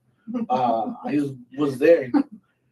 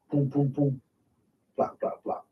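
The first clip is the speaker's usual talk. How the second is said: soft booms, then voiceless rattling bursts; imitated distant gunfire; 6 dB quieter than usual. Soldier recalling gunfire heard from the tent, softly imitated shots. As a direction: mimic muffled shots under your breath. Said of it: "like, the sound".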